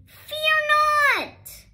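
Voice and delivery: high, loud proclaiming hero cry; theatrical herald's shout; heroic call. A narrator's reading. Ruby Lee's heroic call.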